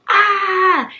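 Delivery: short, high, loud fake horror-movie scream; mock scream. Telling a bat story, mimics a horror-movie victim with a mock scream. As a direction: yelp like someone who just saw a monster. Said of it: "someone sees a monster and they go".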